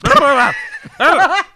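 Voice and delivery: high gobbling squeal; turkey gobble imitation. Heckler's slurred yell coming out like a turkey gobble. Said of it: "so it just came out like".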